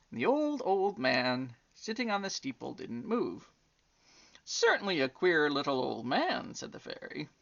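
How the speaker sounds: put-on gentle storybook reading for children; story narration. A narrator's reading. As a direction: read it like a bedtime tale. Reads a bedtime story aloud.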